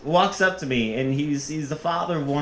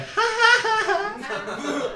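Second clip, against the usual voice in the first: high, forced ha-ha-ha; awkward put-on laugh, pitched way up; fake laugh; much slower than usual. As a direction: laugh along falsely in a high voice. Awkward reply to the praise.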